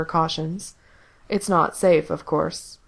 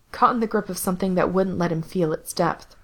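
ordinary delivery

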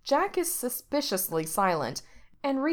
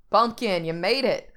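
hollering; 2 semitones higher, 5 dB louder than usual. A narrator's reading. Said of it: "Jack hollers".

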